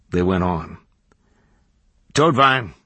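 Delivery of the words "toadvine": raised, higher call to someone leaving. A narrator's reading. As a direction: call out a name, louder and higher. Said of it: "called the barman".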